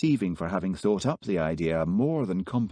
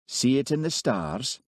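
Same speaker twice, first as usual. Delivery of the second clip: mocking; 7 semitones higher than usual. A narrator's reading. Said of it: "mocked Hamish".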